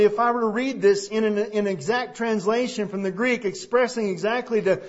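ordinary delivery

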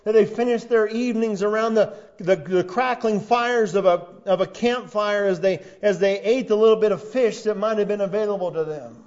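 preaching at full force. Acted. Preacher to his congregation, loud and driving sermon delivery. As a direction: preach it out to the whole room.

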